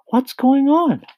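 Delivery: loud, high-pitched startled question; alarmed cry. A narrator's reading. James alarmed by the commotion, crying out high and loud. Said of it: "cried James".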